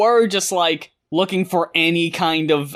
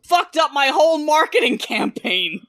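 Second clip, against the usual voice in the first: higher, hammed-up whiny complaint; mocking his response; 7 semitones higher than usual. Host mocks the singer's complaint, higher and hammed-up. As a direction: play up the wounded artist. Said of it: "His response".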